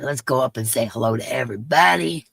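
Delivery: usual speaking voice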